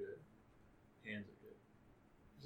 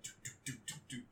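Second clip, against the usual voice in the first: footsteps sound; 18 dB quieter, much faster than usual. Retold or imitated. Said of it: "you heard the footsteps".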